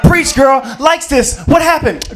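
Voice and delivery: high, loud gossiping friend cheering her on; hyped girlfriend. Retold or imitated. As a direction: shout the hype like a nosy best friend. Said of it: "Her girl's like".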